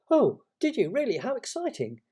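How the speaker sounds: put-on high, eager little boy's reaction; excited interest. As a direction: exclaim with high, swooping delight. Christopher Robin reacts to news.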